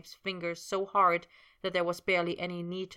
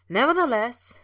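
much higher, louder teasing "Nevertheless"; Potter's playful echo, far higher and louder; mocking echo; 6 semitones higher, 10 dB louder than usual. A narrator's reading. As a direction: parrot his word back to tease him. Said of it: "he echoed".

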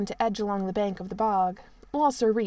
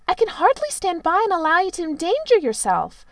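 prim and shocked, higher and louder; scandalized; 9 semitones higher, 8 dB louder than usual. A narrator's reading. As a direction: scold the prince, properly appalled. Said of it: "in a scandalized tone".

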